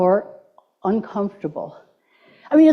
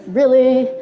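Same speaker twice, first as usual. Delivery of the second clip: mock valley girl. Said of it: "it's my Valley Girl days".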